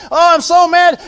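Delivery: high, loud mock angry yell; mock rant. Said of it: "gets mad and screams and yells and rants".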